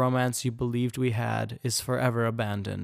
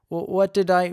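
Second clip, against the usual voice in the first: stammering; 7 semitones higher, 6 dB louder than usual. Acted. Stunned suitor stammering at rejection.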